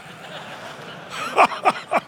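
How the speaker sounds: real burst of high-pitched laughter; laughing. Real, not acted. Preacher cracks up at his own prankster story, real laughter.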